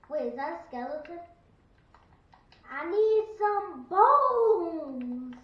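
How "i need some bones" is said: loud, high, very drawn-out call; hammed-up demand. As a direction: stretch BONES into a long holler. Calling for skeleton bones.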